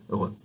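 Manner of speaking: "Heureux" with a throaty French "r"; careful French "Heureux", uvular "r"; French word. Shown as a demonstration. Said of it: "but without actually touching".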